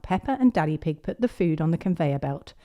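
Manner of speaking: plain reading voice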